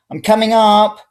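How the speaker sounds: loud, high call upstairs; shouting. Acted. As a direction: announce it at full voice. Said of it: "I shouted".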